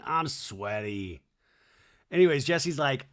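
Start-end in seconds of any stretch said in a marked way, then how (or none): 0.1-1.2 slow, lowered mock reality-star delivery; imitated reality star. Podcaster mimicking Doty opening the scene, slow mock reality star. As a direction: draw it out low and slow, lightly mocking. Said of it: "What a reality star, man".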